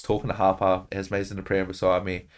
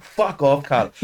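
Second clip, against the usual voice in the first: angry dismissal; 5 semitones higher, 4 dB louder, slower than usual. Retold or imitated.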